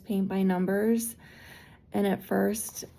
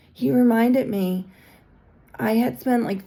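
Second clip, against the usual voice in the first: normal talking voice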